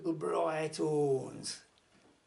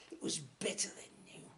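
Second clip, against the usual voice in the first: hushed whisper; 10 dB quieter than usual. A narrator's reading. Hushed aside admitting the bell's quality.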